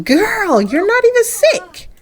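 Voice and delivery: high, drawn-out teasing objection; high-pitched mock-scolding; playful disbelief. Real, not acted. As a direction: call her out way up high. Player teasingly calls out character.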